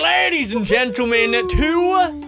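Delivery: big, drawn-out ringmaster welcome; loud, high announcer call, stretching the words; show announcer intro. Pretend. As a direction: belt out a grand show opening. Show-host opening of video.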